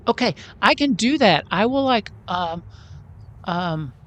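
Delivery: high little voice, feeling for words; Oki, timidly helpful. Acted. Oki offers a song for moral support, high and hopeful. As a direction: pitch it up and offer it hesitantly.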